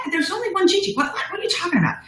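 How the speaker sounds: high, quick, mildly flustered retort; baffled protest. Retold or imitated. Owner retelling her answer to a franchise seeker, baffled. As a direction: replay being totally thrown, words tumbling fast.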